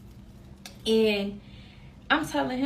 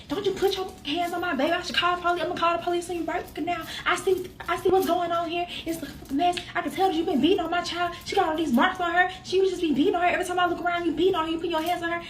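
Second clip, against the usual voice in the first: high-pitched imitation of a scolding mother; mother going in; 7 semitones higher, faster than usual. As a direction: tear into someone protectively, voice raised in pitch. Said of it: "she going in".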